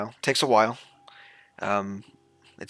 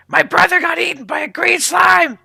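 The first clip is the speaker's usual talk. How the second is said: squeaky kobold voice; 13 dB louder than usual. Acted. Kobold NPC answering.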